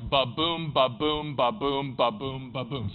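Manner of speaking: "ba-boom" in even pulses, weight on "boom"; drumbeat iambic rhythm. Shown as a demonstration. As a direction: beat out "ba-boom" like a drum, light then heavy. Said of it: "in terms of like a drum".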